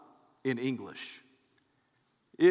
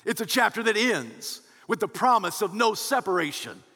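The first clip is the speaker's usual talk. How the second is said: rising sermon climax; 6 semitones higher than usual. Acted. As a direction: build the celebration to full force. Preaching builds to a climax.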